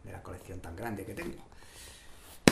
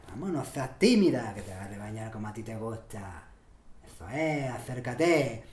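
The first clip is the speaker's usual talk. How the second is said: put-on thick Andalusian accent; 7 dB louder than usual. His urethra woos a woman, thick Andalusian accent. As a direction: lay on a broad, loud Andalusian come-on. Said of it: "empieza a hablar con un acento andaluz cerrado".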